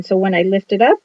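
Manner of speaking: usual speaking voice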